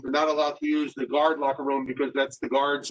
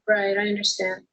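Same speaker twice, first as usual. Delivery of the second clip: echo; 5 semitones higher than usual. Real, not acted. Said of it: "I'm echoing".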